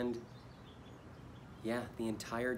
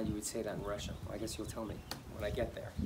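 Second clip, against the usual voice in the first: ordinary talk, nothing marked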